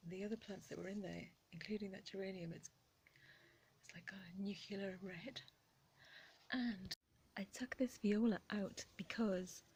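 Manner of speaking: hushed whisper through the garden work. Real, not acted. Gardener explaining the transplanting in a whisper. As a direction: keep it to a breathy murmur.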